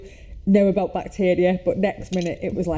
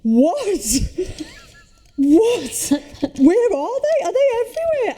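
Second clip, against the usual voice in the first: imitating a panicking child, much higher; high, alarmed kid voice; panicked child; 13 semitones higher, slower than usual. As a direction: squeal it like a freaked-out little girl. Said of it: "and she's like".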